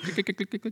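soft, high mouth-made clicking; keyboard clicks. Retold or imitated. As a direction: imitate typing on a keyboard. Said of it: "hears my fingers typing on the keyboard".

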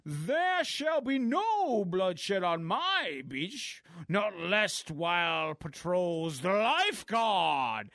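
slow, hammed-up heroic proclamation; mock-Shakespearean superhero decree; pompous lifeguard hero. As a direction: declaim it grandly, drawing out every word. Voicing pompous lifeguard hero in comic.

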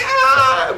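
high, startled laughing shriek; hammed-up high shriek through a laugh. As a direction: squeal in mock panic. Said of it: "I was like".

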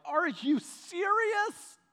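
slow, high-pitched, incredulous question; disbelieving. Retold or imitated. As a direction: stretch it out in disbelief. Finding magazine in trash.

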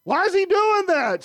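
high, loud mock child asking why; child's puzzled question. Dad imitating his son watching the cartoon, high and puzzled. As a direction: voice a confused little boy. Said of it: "I was like".

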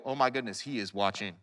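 low, soft voice of inner thought; acted-out wary aside, very low; hushed realization. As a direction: drop right down, as if catching yourself. Acting out his ingrained thought.

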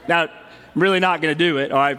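ordinary talk

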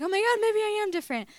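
high, loud, hammed-up weepy cry; tearful realisation. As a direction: wail it in overwhelmed amazement. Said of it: "the tears came again".

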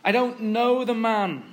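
firm, high-pitched denial; emphatic acted disowning; stronger denial. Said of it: "It's even stronger now".

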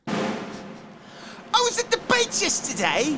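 very high, slow, drawn out; mock dramatic announcement. Host starting a story over drum roll, mock dramatic.

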